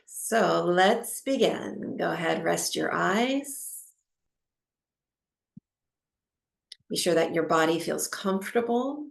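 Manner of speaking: calm, unhurried meditation guide with long pauses; guided meditation. Acted. Teacher settling a class into breathwork, calm guided meditation. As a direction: ease them into stillness, phrase by phrase.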